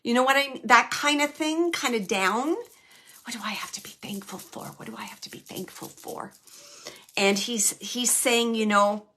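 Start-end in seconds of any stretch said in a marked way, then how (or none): 3.2-6.3 fast, hushed whisper of the father's bitter question; whispered lament of a sad father; whispered quote. Retold or imitated. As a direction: breathe it out quickly as a whisper.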